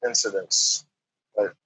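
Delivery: ordinary talk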